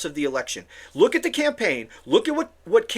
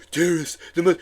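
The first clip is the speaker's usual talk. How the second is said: quick mocking snatch of the politician's mouthy voice; Donald Trump; 5 semitones lower than usual. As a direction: mimic his mangled way of talking. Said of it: "learn to talk".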